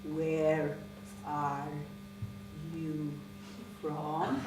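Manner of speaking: low, soft, word by word; slow suspicious question. Acted. Puzzled woman questioning teacher's origin, low halting suspicion. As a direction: leave a long pause after each word.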